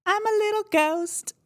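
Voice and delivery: very high, squeaky little spook; playful put-on ghost squeak; tiny ghost voice. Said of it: "a real tiny little voice".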